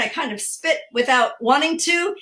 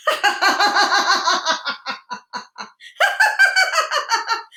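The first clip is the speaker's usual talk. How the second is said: high, rolling peal of forced laughter; big performed laughter-exercise cackle; put-on hearty laugh; 10 semitones higher than usual. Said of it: "we're gonna laugh".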